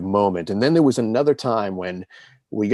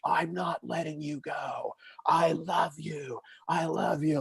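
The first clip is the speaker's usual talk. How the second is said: higher, soft imitation of a sobbing dad; retold sobbing; 8 semitones higher, 6 dB quieter than usual. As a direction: lightly voice the dad pleading through tears. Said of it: "And he's sobbing again. He's like".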